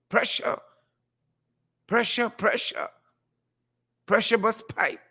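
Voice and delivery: high put-on catchphrase voice; spaced-out, high-pitched character refrain; Crab Man. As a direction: raise the pitch for a playful impression. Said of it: "in my best Crab Man voice".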